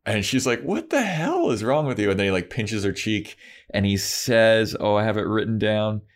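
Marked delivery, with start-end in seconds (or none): 0.6-2.1 high-pitched, indignant imitation; retold appalled reproach; mock outrage. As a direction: demand it in hurt disbelief, lightly hammed-up. Acting Buffy's outraged reply to Angel.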